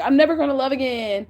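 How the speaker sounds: mock crying. Reader mimicking someone crying over lost love, mock crying. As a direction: pretend to wail over heartbreak. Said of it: "start crying like".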